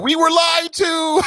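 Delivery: high put-on whiny voice; mocking whine. Host mocking duped fans in a high whiny voice. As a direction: squeak it like a duped believer.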